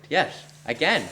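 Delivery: put-on playful high Indian accent. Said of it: "I can do an Indian accent".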